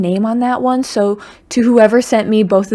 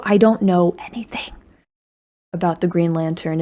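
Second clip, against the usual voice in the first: whispered and much softer, like a guilty confession; sheepish whisper; 4 semitones lower, 3 dB quieter than usual. Real, not acted. Creator confessing to comic fans, embarrassed whisper. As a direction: hush it as if admitting a secret.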